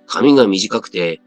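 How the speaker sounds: ordinary delivery, nothing marked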